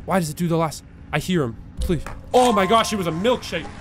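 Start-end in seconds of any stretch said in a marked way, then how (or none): 2.3-3.7 high startled exclamation. Real, not acted. Player jolted by the game, startled. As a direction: burst out in shock.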